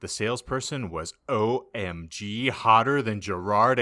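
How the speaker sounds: ordinary talk, nothing marked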